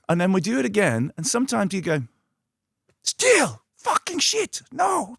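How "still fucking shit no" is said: much higher, louder disgusted mock director. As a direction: spit out the rejection in a high put-on voice. Mimicking director's rejection.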